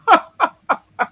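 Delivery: maniacal laugh. Acted. Mad scientist laugh.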